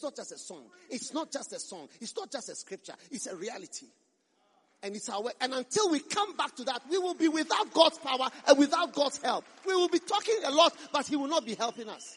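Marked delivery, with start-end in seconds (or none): 4.8-12.2 high, very loud warning; forceful preaching. Real, not acted. Preacher warning the church it will lack God's help, impassioned shout. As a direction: thunder it out to the congregation.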